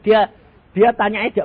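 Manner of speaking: ordinary talk, nothing marked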